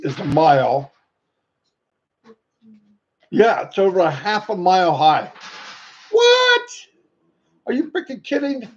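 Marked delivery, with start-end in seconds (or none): 6.1-8.7 incredulous outburst. Real, not acted. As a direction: react in real disbelief, pitch leaping up. Reacting to the building's height.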